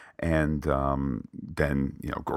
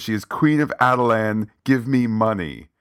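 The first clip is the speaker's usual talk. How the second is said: loud imperious queen, pitched higher; regal demand; 5 semitones higher, 6 dB louder than usual. Retold or imitated. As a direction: bark it like a queen. Queen demanding money from ATM.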